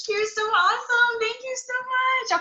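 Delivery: host thrilled by the guest's pep talk; real gushing. Real, not acted. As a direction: gush over the guest. Host gushes after guest's speech.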